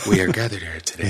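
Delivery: hushed, solemn funeral tone. Acted. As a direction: speak like a minister opening a funeral service. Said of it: "It's funeral voice".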